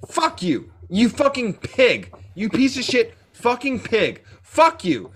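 high, heated insults with falling punches; real angry yelling. Real, not acted. Podcaster cursing out profiteers, angry yelling. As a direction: hurl each insult in real anger. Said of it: "I'm fucking yelling and freaking out".

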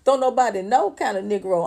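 ordinary talk

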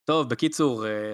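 normal talking voice

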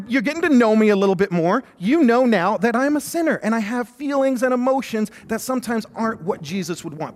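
normal talking voice

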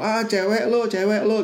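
lightly acted name-calling; mock taunting. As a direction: imitate kids teasing a classmate. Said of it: "dia suka dicela-cela gitu sama temennya".

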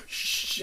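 long, voiceless imitated shush; drawn-out mock hushing; big-deal shush. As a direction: exaggerate a fussy "shh". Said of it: "making a big deal".

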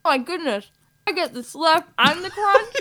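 high, delighted, laughing through it; excited radio ad customer. Acted. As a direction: gush over the first bite. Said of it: "two people in conversation".

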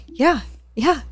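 a hint of her mom agreeing. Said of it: "My mom's like".

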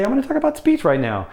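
fast, very high-pitched talk; high, quick demo voice; really high voice. Shown as a demonstration. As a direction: rattle it off way up high. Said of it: "really high".

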